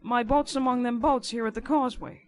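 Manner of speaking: emphatic informer's character voice; lightly voiced rough informer. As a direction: answer back forcefully as the riverman. Narrator voices Riderhood arguing back.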